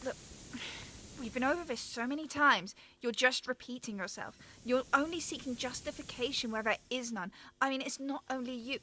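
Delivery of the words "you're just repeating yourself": usual speaking voice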